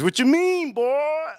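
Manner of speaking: high-pitched mock woman's retort; stripper imitation. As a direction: imitate her in a high falsetto. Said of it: "She goes".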